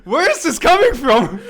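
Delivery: high, loud question through laughter; laughing disbelief. Retold or imitated. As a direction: ask it while cracking up. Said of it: "I'm like".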